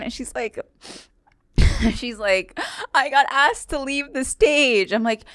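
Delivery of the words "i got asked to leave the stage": high, drawn-out imitation of a tearful woman; mock tearful complaint. Crying heckler complaining to the comic, mock tearful. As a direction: whine it slowly, imitating someone in tears. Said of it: "like, tears".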